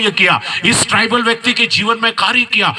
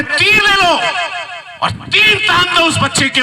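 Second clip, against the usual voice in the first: high, commanding order; commander ordering; 12 semitones higher than usual. Acted. Commander ordering soldier to aim arrow, high command. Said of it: "एक सैनिक को बोला".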